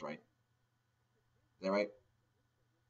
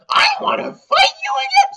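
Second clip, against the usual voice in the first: much higher, louder, bouncy challenge; eager rival; 13 dB louder than usual. Retold or imitated. Rival challenging the player again, high and eager. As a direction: demand a rematch like a pushy kid.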